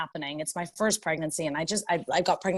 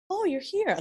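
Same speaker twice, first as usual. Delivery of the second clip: high-pitched imitated surprise; mock startled welcome; taken aback; 11 semitones higher than usual. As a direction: greet someone while caught off guard, voice up high. Staff react to pregnant arrival.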